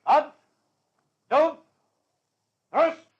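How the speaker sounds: loud, high parade-ground orders; officer shouting orders to the firing squad, loud and high; barked commands. Acted.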